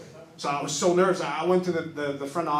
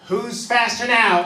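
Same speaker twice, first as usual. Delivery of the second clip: higher, louder, slow smug taunt; 7 semitones higher, 8 dB louder, much slower than usual. Retold or imitated. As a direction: gloat it out slowly. Mom taunting on phone.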